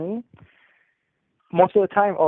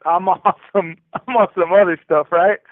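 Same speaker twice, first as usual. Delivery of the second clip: laughing tough boast; 3 semitones higher, 9 dB louder than usual. Retold or imitated. Retold boast to cellmate.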